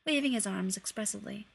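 plain reading voice

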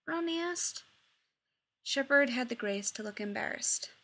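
ordinary delivery

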